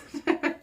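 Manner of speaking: high, loud short laugh; quick burst of giggles; amused laugh. Real, not acted. Amused by trainer's joke.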